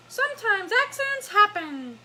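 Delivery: high, squeaky puppet voice; Elmo voice. Acted. Elmo consoling Albie about accidents, high Elmo voice. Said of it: "Elmo says".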